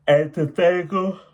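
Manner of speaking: "antithetical" punched high and loud, rising; emphatic word. Shown as a demonstration. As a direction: belt out "antithetical" with a lift at the end. Stressing the vocabulary word.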